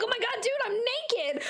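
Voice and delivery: high-pitched embarrassed protest; comic squeal of dismay; mock shocked outburst. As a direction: react in hammed-up mortification.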